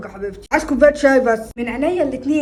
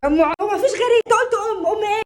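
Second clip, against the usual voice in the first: high, heated challenge; raised voice; 5 semitones higher than usual. Acted. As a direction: pick a fight with your voice up. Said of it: "ما تعليش صوتك".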